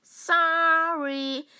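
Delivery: mock robot apology; Rico impression. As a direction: stretch the sorry out cheekily. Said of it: "Das ist Rico".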